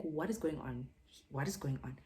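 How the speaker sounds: low, quiet imitation of a fuming boyfriend; restrained, fuming demand; contained rage. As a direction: retell his anger in a low, controlled voice. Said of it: "he's raging and he's fuming".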